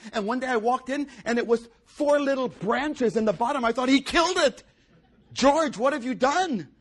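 high, loud dismay at the bare vine; loud, high retold shock at the trimmed grapevine; mock horror. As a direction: cry out as if the vine were dead. Retold shock at cut plant.

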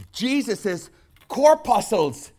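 high, loud shouted preaching, held; preaching at full force. Acted. Preacher shouting Jesus' agony to the congregation, high and loud. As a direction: hammer it home at the top of your voice.

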